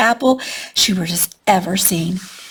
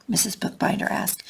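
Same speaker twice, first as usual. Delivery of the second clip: usual speaking voice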